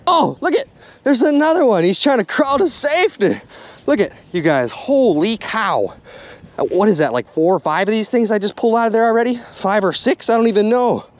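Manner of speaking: ordinary talk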